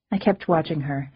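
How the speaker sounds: ordinary delivery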